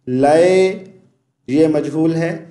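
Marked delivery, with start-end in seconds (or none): none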